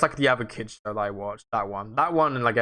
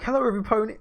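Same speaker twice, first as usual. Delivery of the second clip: higher, blurted "Hello, every pony"; awkward pony greeting; 9 semitones higher, much faster than usual. Retold or imitated. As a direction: blurt it out too high. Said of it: "I was like".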